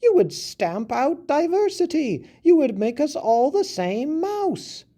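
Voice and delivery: a tiny mouse shocked and protesting; high mouse voice crying out in disbelief. A narrator's reading. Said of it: "the mouse cried out".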